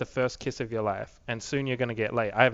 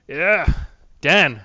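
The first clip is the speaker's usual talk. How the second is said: loud, higher hype-up yelling at a friend; hyped shout; 5 semitones higher, 9 dB louder than usual. Real, not acted. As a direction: fire yourself up and call him out, loud. Hyping himself up, calling out friends.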